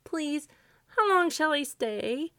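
high, slow, polite question; scarecrow voice. A narrator's reading. Scarecrow asks Santa about his stay, high voice. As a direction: ask in a high character voice.

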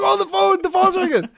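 squeaky excited mock voice; high-pitched teasing voice. Mocking cohost answering phone.

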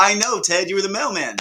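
high, loud comic exasperation; mock exasperation. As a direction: protest while on the edge of laughter. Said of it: "Back then I'm like".

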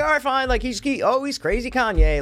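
high, mocking shrug-off voice; dismissive public voice. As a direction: brush it off in a high mock tone. People shrugging off [name].

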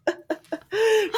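high laugh; bright burst of laughter. Real, not acted. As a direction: let out a real laugh. Laugh after story.

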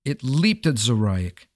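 plain reading voice

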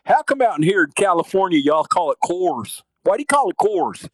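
indignant Texan coworker. Retold or imitated. Texan busboy griping about the beer's name, indignant drawl. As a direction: imitate a Texan arguing his pronunciation, rising in pitch. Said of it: "he used to always go".

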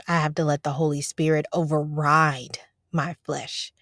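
ordinary talk

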